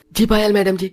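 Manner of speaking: a meek servant eager to please; timid office peon. Acted.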